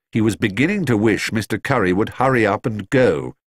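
plain reading voice

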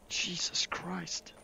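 breathless whisper of wonder; awed whisper. Real, not acted. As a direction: barely breathe the words. Awed at the view in VR.

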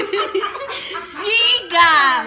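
cackling through the words; laughing hard, high and loud; burst of laughter. Real, not acted. Laughing after the slap.

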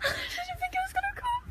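real relief breaking into teary laughter; tearful laugh. Real, not acted. After waiting ages for the train, laughing through tears.

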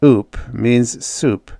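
ordinary delivery, nothing marked

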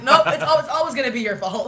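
laughing mock scold. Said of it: "she's like".

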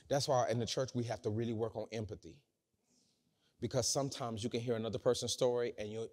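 ordinary talk, nothing marked

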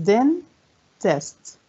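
"dentist" split as den, pause, tist; syllable by syllable. Shown as a demonstration. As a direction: count out both parts of "dentist". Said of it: "كم عدد المقاطع".

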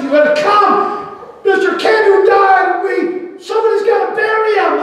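retold panicked call to come bury the dead; urgent shout. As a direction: shout the summons as if bursting in with bad news. Church members calling him urgently.

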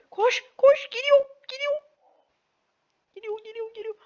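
very high panicked cries; being chased. Real, not acted. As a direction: flee screaming run, he's coming. Fleeing from enemy. (kovalanırken panik içinde)

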